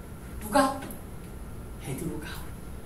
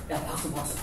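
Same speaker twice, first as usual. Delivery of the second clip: whisper; 5 semitones lower, 9 dB quieter than usual. Acted. Jesus cheering for Peter.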